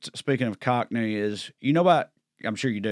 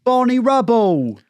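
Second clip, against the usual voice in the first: Don Cheadle's bad Cockney; 10 semitones higher, 6 dB louder than usual. Host mimics the film's rhyming-slang punchline, shouted mock Cockney. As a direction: belt it out in a hammed-up bad Cockney. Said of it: "gotten shit on a lot for that accent".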